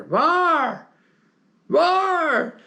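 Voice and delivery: high, loud Irish shout across the camp; Irish calling out. Retold or imitated. Lieutenant calling for his mate at night in a loud Irish yell. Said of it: "Irish accent".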